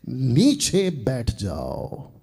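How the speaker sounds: very slow, drawn-out divine command; slow scriptural pronouncement; solemn slow command. Retold or imitated. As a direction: stretch each word out as a stern decree. Acts out God's command to king.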